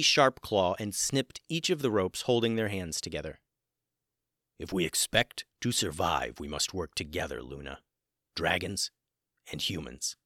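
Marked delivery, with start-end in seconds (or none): 4.6-10.2 low, soft dragon voice. A narrator's reading. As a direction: speak low like a wise dragon. Gray Dragon speaking.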